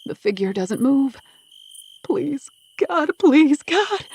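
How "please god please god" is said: high, anguished begging, growing louder; frightened prayer, building; desperate plea. A narrator's reading. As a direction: plead as if a life hangs on it. Desperate plea over still body.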